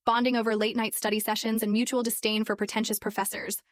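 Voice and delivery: usual speaking voice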